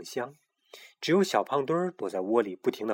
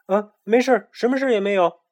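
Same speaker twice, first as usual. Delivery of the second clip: high, loud hasty denial; flustered denial; 9 semitones higher, 7 dB louder than usual. A narrator's reading. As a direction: cover up quickly. Hiding secret, flustered. (慌忙掩饰)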